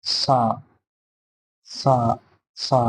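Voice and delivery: সা with the tongue touching the upper teeth. Shown as a demonstration. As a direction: say সা, tongue tip up against the top teeth. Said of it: "ঠিক ওরকম ভাবে হবে".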